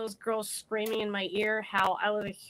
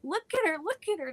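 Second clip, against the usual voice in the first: higher mocking taunt of the girls; mocking bullies; 9 semitones higher, faster than usual. Bullies taunting the drowning girl, higher mocking jeer. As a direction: jeer like a laughing mean girl, pitched up. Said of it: "and they're just".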